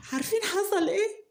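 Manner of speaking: high, loud build-up to a secret; teasing the audience before the kiss reveal; teasing excitement. A narrator's reading. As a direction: hook the listeners, way up high.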